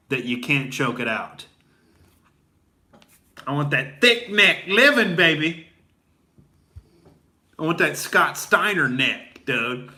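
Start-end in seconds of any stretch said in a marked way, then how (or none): 3.5-9.9 hyped bro shout. Acted. Hyped gym bro exclamation.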